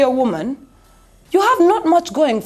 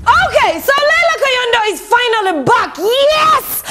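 high, triumphant announcement rising to a yes; excited; 9 semitones higher than usual. Real, not acted. Host announcing a singer's comeback to viewers, excited. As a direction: crow it high, drawing out the celebration. Celebrating the gossip subject's return.

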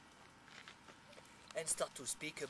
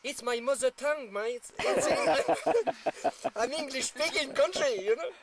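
much louder and higher, laughing through the quote; 6 semitones higher, 12 dB louder than usual. Retold or imitated. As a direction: deliver his comeback while cracking up. Quotes Shane amid loud laughter.

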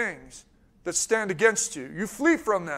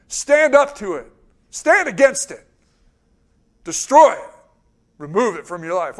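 louder with each command, building to a forceful cry; rising preacher's call; 7 dB louder than usual. Real, not acted. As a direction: drive each command home harder. Preacher urging flock to fight.

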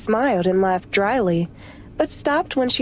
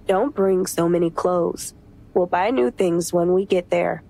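lowered voice for the husband; lower male voice; 6 semitones lower than usual. Acted. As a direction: play a calm husband, pitched down. Chase's voice.

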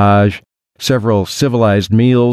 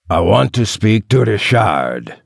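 put-on French-accented caller with a touch of anger; angry French accent. A narrator's reading. French caller demanding Richard from a stranger, mildly angry French accent. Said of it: "The man sounded angry".

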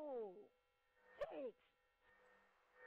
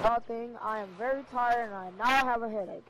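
throat mic; 4 semitones lower, 13 dB louder than usual. Real, not acted. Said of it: "the Deep Throat Mike".

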